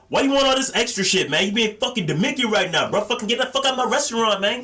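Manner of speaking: angry cook kicking a fussy customer out of his restaurant; mock angry cook. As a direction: yell like a fed-up cook throwing out a customer. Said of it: "And they're like".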